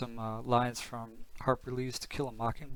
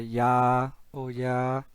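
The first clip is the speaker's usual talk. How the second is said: drawn-out Minnesota "yeah"; Minnesota accent; 8 dB louder, much slower than usual. Retold or imitated. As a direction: imitate the northern Minnesota way of saying "yeah". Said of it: "Maybe you heard a lot of the".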